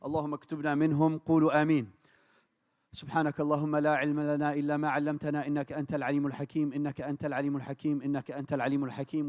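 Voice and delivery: ordinary delivery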